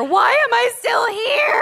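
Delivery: put-on high, slow, wailing old lady; whiny old grandma. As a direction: moan it like a tired grandma. Grandma's daily lament, quoted.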